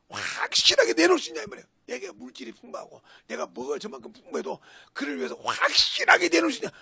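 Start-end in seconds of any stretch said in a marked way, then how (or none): 0.1-1.7 challenging shout. Real, not acted. Challenging the congregation. 5.4-6.7 challenging shout. Real, not acted. Repeats challenge.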